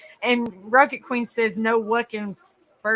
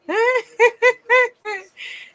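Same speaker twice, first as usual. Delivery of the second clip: high delighted laugh; 13 semitones higher than usual. Real, not acted. Laughing at own accent try.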